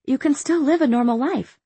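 desperate. A narrator's reading. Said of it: "she said desperately".

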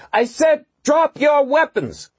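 very high, full-voice slow yell. A narrator's reading. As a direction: yell at the top of her voice. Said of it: "she yelled at the top of her voice".

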